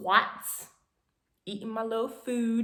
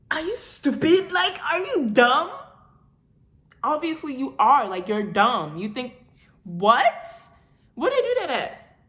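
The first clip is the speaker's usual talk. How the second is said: loud, high mocking disbelief; incredulous scorn; 5 semitones higher, 5 dB louder than usual. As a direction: talk down to him like he's clueless. Incredulous at boyfriend's dinner idea.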